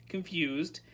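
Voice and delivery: plain reading voice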